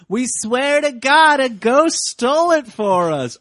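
put-on high, slow pleading kids; kids' voices. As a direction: lightly mimic kids swearing they're innocent. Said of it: "And they're like".

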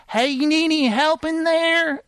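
high sing-out offer; put-on high hostess voice; woman calling through a door. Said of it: "and you'll hear".

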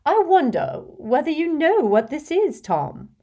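put-on fussy, gushing rich old lady, laughing in; a vain old witch showing off to a charming boy; giggly hostess voice. Hepzibah teasing Tom.

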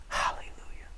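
very soft whispered hallelujah; whispered praise. Real, not acted. Preacher praising after the miracle is read, whispered. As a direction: breathe it out under the voice. Awed aside after the scripture climax.

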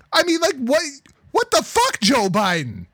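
real shock, voice jumping up high; incredulous outburst. Real, not acted. Outraged at Biden's statement.

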